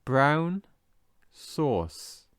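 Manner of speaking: BROWN rising and stretched, pause, SAUCE falling; word by word. Shown as a demonstration. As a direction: separate "brown" from "sauce" with a clear gap. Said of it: "And again".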